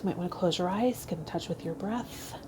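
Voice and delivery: soft, fading meditation guide voice; hushed meditation cue; guided meditation. Acted. Starts a short guided meditation.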